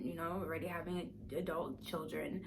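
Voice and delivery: ordinary talk, nothing marked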